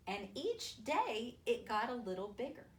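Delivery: ordinary talk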